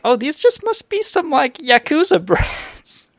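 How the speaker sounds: high, slow falsetto with a laugh; giggly high "Yakuza brats" guess; mock realization. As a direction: squeal the thought in a silly high voice. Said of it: "I'm like".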